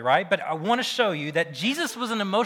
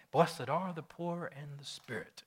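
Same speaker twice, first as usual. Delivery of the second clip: soft, slow, controlled deep-voice impression; Spock; 8 dB quieter than usual. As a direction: deliver it cold and measured, a rough attempt at a character. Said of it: "very Spock-like, just deep voice and very controlled".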